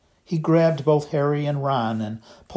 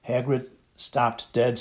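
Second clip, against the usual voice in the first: ordinary delivery, nothing marked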